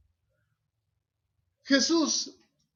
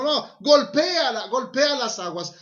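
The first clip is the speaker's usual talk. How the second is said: raised, louder retold order; emphatic imperative, pitched up; acted urgent command; 6 semitones higher, 8 dB louder than usual. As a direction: urge him on forcefully. Voicing God's order to Moses.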